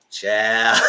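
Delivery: loud exclaim into laughter. Real, not acted. Reacting to the drama with a loud child and a laugh. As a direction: shout it then crack up.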